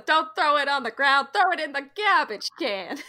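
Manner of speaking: mock Italian-American scolding; hammed-up New York Italian accent; Italian New Yorker. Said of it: "We need an Italian New Yorker".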